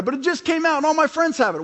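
put-on whiny kid. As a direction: play the son begging for the new toy. Son Elliot whining for a new thing.

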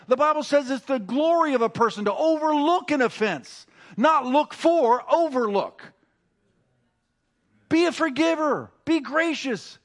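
rally the congregation, pitch climbing; preaching at full force. Acted. Pastor urging the church to forgive, preaching at full force.

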